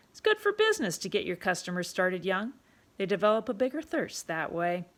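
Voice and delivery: put-on loud, bossy barkeep calling the boy in; a pushy tavern keeper ordering a kid about; rough London publican, loud and commanding; gruff pub owner. Pub owner voiced in story.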